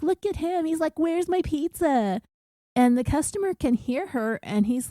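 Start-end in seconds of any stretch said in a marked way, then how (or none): 0.0-2.2 higher-pitched mocking imitation; high mock-whiny jeer at someone. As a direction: mimic a customer in a high, softish taunt. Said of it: "she starts mocking".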